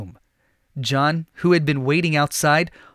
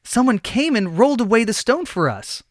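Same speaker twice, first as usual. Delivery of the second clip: higher, wondering voice; amazed; 7 semitones higher than usual. A narrator's reading. Women finding the stone gone, amazed. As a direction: marvel at the open tomb. Women find tomb open.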